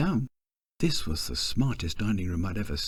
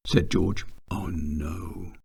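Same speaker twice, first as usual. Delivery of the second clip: usual speaking voice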